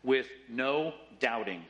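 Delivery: "no doubting" slow and weighted; emphatic, spaced-out words. Shown as a demonstration. Preacher showing the verse's condition on faith, slow emphatic "no doubting". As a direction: set "no" apart, then land "doubting" slowly. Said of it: "It's emphasized".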